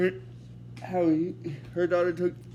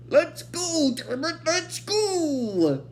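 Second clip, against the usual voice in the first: high, strained impatient outburst, last "go" drawn out; mock-annoyed high-pitched holler; annoyed professor; 9 semitones higher, much slower than usual. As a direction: imitate an exasperated old boss ordering everyone out. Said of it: "the professor gets annoyed by that".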